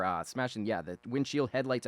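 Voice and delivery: ordinary delivery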